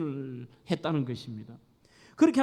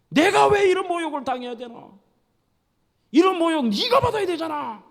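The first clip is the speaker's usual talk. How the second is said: much higher, louder angry yelling; furious shout at husband; 9 semitones higher, 7 dB louder than usual. Acted. Wife screaming blame at her husband. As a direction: scream it at him, full rage. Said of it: "남편에게 막 소리를 지는 거예요".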